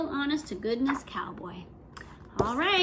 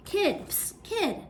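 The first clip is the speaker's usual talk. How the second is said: higher, lightly gruff outlaw hiss; a hint of a gruff outlaw; 6 semitones higher, much slower than usual. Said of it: "a gruff voice said".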